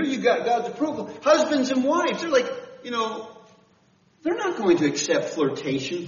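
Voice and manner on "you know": ordinary delivery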